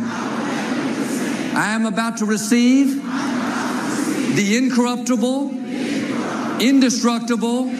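slow, measured call-and-response declaration; unison Bible confession. Acted. Preacher leading the church in a slow confession. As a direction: declare each line slowly for the church to repeat.

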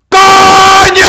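huge high scream of ¡Coño!. Shown as a demonstration. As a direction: let ¡Coño! rip at the top of your lungs. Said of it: "es así como va un coño". (grita ¡Coño! con todas tus fuerzas, muy agudo y fuerte)

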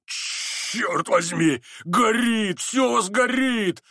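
high, drawn-out alarmed cry. A narrator's reading. The gentleman cries out on seeing the flames, alarmed cry. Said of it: "вскричал он, увидев пламя".

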